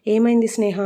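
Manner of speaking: plain reading voice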